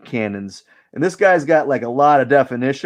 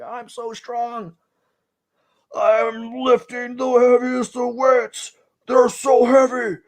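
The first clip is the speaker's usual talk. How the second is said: much higher, slow, straining meathead voice; mock strongman; 12 semitones higher, slower than usual. Artist voicing the bodybuilder he draws, high hammed-up strain. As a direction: ham up a muscle guy groaning under the weight. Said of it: "He's like".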